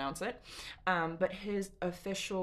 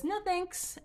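very high, light no thanks; airy polite no; breezy refusal; 14 semitones higher than usual. Retold or imitated. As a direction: brush him off lightly. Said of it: "and she says".

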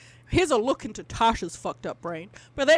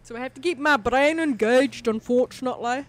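bad mock Scottish accent; 4 semitones higher, 6 dB louder than usual. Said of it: "on a bad accent".

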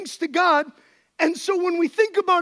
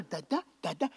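put-on soft coaxing baby talk; 9 semitones lower, 7 dB quieter than usual.

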